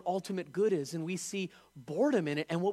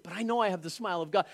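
fast, back at normal level; quick murmured repeat; faster than usual. Acted. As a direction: rush it through as an inner reassurance. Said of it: "repeat, quicker and softer".

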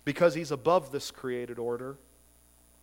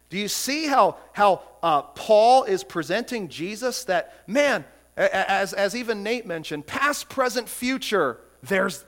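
loud, raised pulpit preaching; impassioned preaching; 5 semitones higher, 7 dB louder than usual. Acted. Pastor proclaiming God the Son to his church, loud and fervent. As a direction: proclaim it with full force. Pastor exalts Jesus to congregation.

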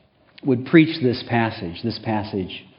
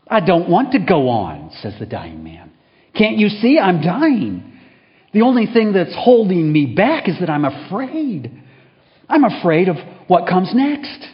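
louder, higher desperate pleading; desperate plea; 7 semitones higher, 5 dB louder than usual. Acted. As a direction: beg like a frightened dying man. Dying man pleads with chaplain.

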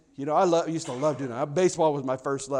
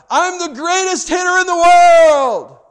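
big, much higher and louder, slow boast; boy's shout; 12 semitones higher, 13 dB louder, slower than usual. A narrator's reading. Little boy in his backyard shouting his boast. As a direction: shout it like a kid in the World Series. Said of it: "he shouted out".

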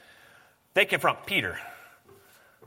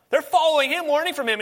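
rising fervor; 12 semitones higher, 4 dB louder than usual. Real, not acted. Preacher building intensity.

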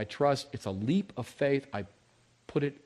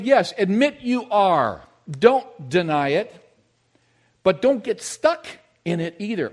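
urging slowly; 7 semitones higher, 8 dB louder, slower than usual. Acted. Preacher urging congregation.

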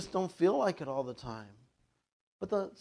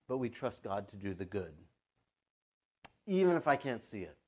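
ordinary delivery

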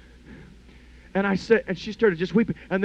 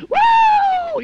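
very high, loud "Woo!" whoop; drunk in the spirit whoop; 10 dB louder than usual. Retold or imitated. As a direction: let out a wild joyful holler. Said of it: "and she says".

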